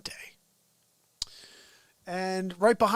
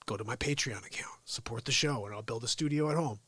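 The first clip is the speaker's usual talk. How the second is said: quiet at night; 8 semitones lower, 5 dB quieter than usual. Real, not acted. Late night, family asleep.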